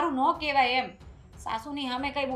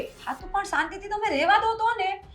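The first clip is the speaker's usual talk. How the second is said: higher and louder, finally snapping back; exasperated outburst; 4 semitones higher, 8 dB louder than usual. Acted. Daughter-in-law finally yells at her mother-in-law in exasperation. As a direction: let it burst out after holding back all along.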